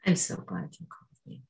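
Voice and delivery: soft unvoiced reassurance; gentle whisper. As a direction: whisper it kindly. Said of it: "She goes".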